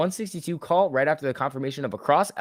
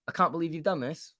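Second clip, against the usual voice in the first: ordinary delivery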